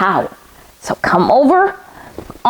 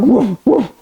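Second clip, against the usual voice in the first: dog barks. Pretend. Teacher barking with kids in dog pose, playful woofs. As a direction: bark along like a happy puppy. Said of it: "now we're dog".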